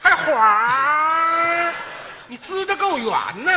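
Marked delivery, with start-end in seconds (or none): 0.0-1.7 long drawn-out mock whoosh; incredulous imitation of a gushing sound; mocking echoed gush. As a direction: throw the crying noise back, stretched out. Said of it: "有这么哭的吗？".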